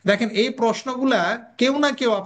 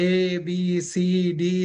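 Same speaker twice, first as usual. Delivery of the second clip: slow sing-out of "ABCD" with every letter stretched; drawn-out letters; 2 semitones lower, much slower than usual. Shown as a demonstration. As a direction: drag out each letter slowly, "ABCD". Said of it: "টেনে টেনে".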